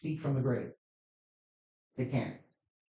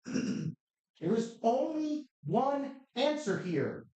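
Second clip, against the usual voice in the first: closing argument; 4 semitones higher than usual. Acted. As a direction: press the jury firmly. Prosecutor closing argument to jury.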